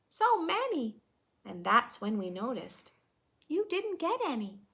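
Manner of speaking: put-on lively read-aloud for kids; children's story narration; storybook reading. A narrator's reading. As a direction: read the rhyme to a class. Reading picture book aloud.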